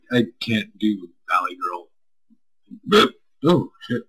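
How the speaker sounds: usual speaking voice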